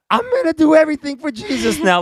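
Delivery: very high, fired-up new believer; zealous excitement. Retold or imitated. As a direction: squeal it like an eager teen convert. Teen convert's zealous vow.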